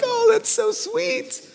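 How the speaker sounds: very high, slow, cooing "Oh, that's so sweet"; gushing mom. Retold or imitated. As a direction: coo it up high like a doting mother. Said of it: "It's like".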